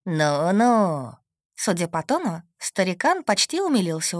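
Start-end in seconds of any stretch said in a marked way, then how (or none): 0.0-1.2 a hint of an amused old man; drawn-out, lightly aged voice; lightly voiced old man. Old man answers the werewolf's threat, amused.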